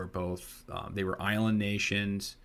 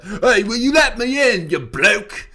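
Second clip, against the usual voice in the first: put-on much higher, loud drunken British yob; drunk British lad; 14 semitones higher, 15 dB louder than usual. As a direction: beg the bouncer like a wasted lad. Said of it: "all fucking piss wasted".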